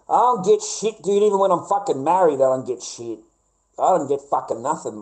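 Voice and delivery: high, loud exasperated yelling; frustrated shout. Real, not acted. Venting to mates about marriage, high loud exasperated yell. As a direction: blow up about getting nothing at all.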